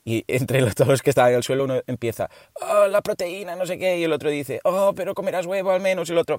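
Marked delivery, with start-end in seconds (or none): 2.6-4.0 higher, put-on groaning objection; mock whining soldier. Dead soldier on battlefield rises to nag about protein. Fallen soldier in vegan joke. 4.6-6.1 higher, fussing concerned voice; mock nagging soldier. Another fallen soldier fussing about eggs, higher mock concern.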